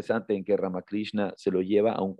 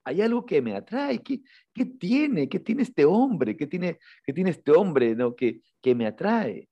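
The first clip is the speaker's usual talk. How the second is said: much higher and slow, spellbound puzzling; fascinated wondering; 7 semitones higher, slower than usual. Retold or imitated. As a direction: wonder aloud, drawn in despite himself. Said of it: "Pero dice". (Narendra se pregunta fascinado, muy agudo y lento)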